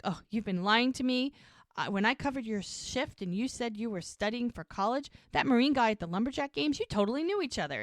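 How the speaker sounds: mock-indignant, gathering speed and pitch; very upset. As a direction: retell an upset girlfriend working it out aloud. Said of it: "And she's getting very upset".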